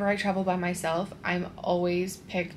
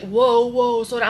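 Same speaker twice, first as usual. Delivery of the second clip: louder, higher mock guard; imitated halting shout; 4 semitones higher, 8 dB louder than usual. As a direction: cut in loudly to stop someone. Retold guard halting her at conveyor.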